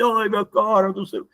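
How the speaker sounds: a touch of mock despair at the mirror; anguished sigh at the mirror. Student sighing at the mirror in mock anguish. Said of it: "fala assim".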